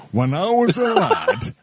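like a grandad starting a tale; old-timer voice. Pretend. Reminiscing host in mock old-man voice.

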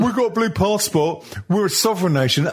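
mocking Brexit voter. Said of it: "mocking Brexit voters".

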